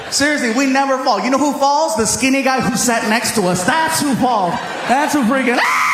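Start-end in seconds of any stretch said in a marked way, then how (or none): 5.6-5.9 high, long mock scream; hammed-up high shriek; falling scream. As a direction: scream like a rider flung from a coaster. Acts out skinny rider falling out.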